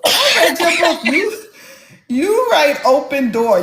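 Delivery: high, emphatic exasperated retort; mock insistence. Host correcting a doubtful author about her book, high mock-exasperated insistence. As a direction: act out a friend setting the writer straight. Acting Nikki's insistent answer.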